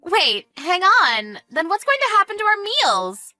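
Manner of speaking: high, drawn-out whiny alarm; panicked. Acted. Panics about losing meals.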